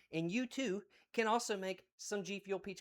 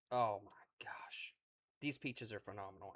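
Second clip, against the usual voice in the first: hushed awe; 12 semitones lower, 10 dB quieter than usual. Real, not acted. Awed by juicy peach.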